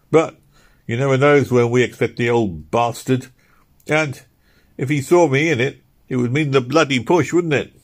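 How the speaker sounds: lightly voiced labourer moaning about the cold; Philpot's voice.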